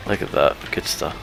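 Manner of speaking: normal talking voice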